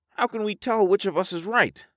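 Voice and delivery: very high character voice; high-voiced Alvar, questioning. Alvar doubting all three hunches, very high voice. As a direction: plead the question in a high register.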